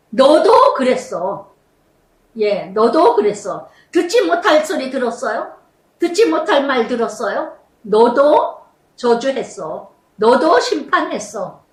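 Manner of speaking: slow, weighty, deliberate; solemn rebuke from the pulpit; Spirit's rebuke. Acted. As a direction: let each accusation land slowly on the listeners. Preacher voicing the Spirit's rebuke.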